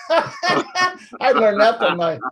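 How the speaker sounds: talking through a real laugh. Real, not acted. Teacher admits where he learned all y'all, laughing. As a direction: let the laugh spill into the words, slowing them. Laughs recalling sister-in-law's southern plural.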